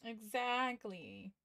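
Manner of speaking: drawn out. Real, not acted. Drawn-out agreement with a friend.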